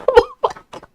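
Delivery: a very high, short burst of laughter; sudden laugh. Real, not acted. Hearing advice to get hit in the face, a sudden high laugh. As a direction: snort a laugh at the absurd advice.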